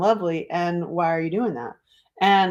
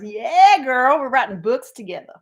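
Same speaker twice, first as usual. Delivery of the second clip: excited; 6 semitones higher, 5 dB louder than usual. Retold or imitated. Imitating the girl's reply.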